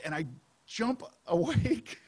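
laughing through the words; amused. Real, not acted. Preacher telling how he jumps awake, laughing.

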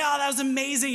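high, loud, gushing delight; carried away with bliss; ecstatic. Acted. Acting post-sex delight.

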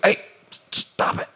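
a quick hushed telling-off of your own thoughts; hushed self-scolding. Retold or imitated. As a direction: whisper it sharply to yourself. Telling own mind to stop.

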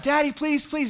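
high, loud desperate plea; child screaming please. Retold or imitated. Trapped son screaming to his father through the door, desperate. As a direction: beg like a frightened little boy. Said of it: "as he screamed out".

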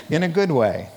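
normal talking voice

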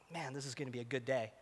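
very soft, low musing; quiet contentment. Retold or imitated. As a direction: think it softly to yourself, relieved. Calm moment before the action.

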